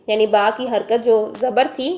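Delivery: usual speaking voice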